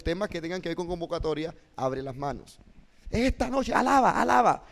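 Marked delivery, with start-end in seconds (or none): 3.1-4.7 high, ringing call to praise; preacher's invitation. Shown as a demonstration. Preacher calling people to seek God, high rousing invitation. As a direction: summon the congregation with a lifted voice. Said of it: "Cuando estás hablando invitación".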